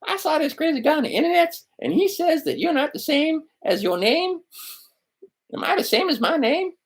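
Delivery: put-on high, wide-eyed kid asking innocently; squeaky little kid puzzling it out; child's voice. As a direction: play a curious young child. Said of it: "you might have your children do this".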